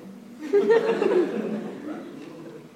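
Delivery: squealing laugh; high laugh. Real, not acted. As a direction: crack up. Laughing at own story.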